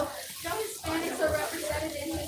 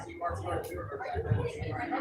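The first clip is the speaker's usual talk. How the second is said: disbelieving whisper; 11 semitones lower than usual. Retold or imitated. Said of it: "I'm just like".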